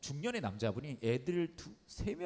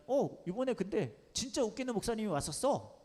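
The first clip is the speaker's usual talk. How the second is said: a hint of a higher little girl voice; child answer; 9 semitones higher than usual. Daughter answering her dad, lightly voiced higher. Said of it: "그 아이도 안 해도 되는 말".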